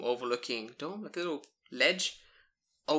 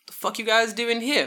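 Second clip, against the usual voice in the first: higher, louder mock police challenge; gruff cop; 9 semitones higher, 7 dB louder than usual. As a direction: bark it like a cop catching kids. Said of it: "He's like".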